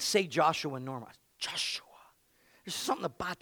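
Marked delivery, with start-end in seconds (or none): none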